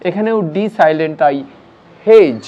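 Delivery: ordinary talk, nothing marked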